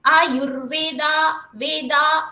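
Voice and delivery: आयुर्वेदा sung slowly with वे held long; melodic, drawn-out वेदा; long vowels. Shown as a demonstration. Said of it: "उसको दीर्घ बोलेंगे".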